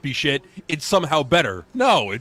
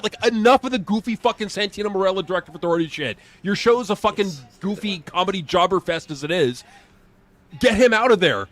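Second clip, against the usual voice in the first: normal talking voice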